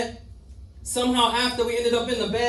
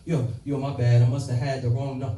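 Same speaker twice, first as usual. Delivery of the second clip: deeper voice for the other man; lower man's voice; 7 semitones lower than usual. Retold or imitated. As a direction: drop low for the other guy's lines. Chad voiced by the narrator.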